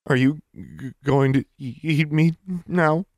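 put-on frozen girl stuttering in fear; scared stammer. Frightened girl stammers question.

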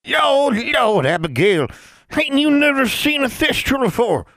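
high, loud cackling crone; mock her like a haughty old witch; scolding old crone. A narrator's reading. Elder witch scolding Abigail.

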